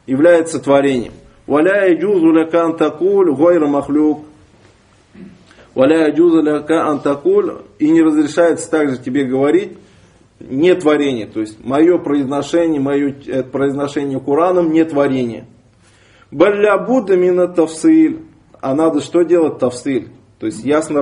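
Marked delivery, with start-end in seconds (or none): none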